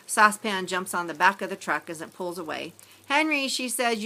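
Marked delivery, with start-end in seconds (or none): 3.1-3.5 higher call of a name. A narrator's reading. Grammy to Henry, a fond higher call. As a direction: call him back as he leaves.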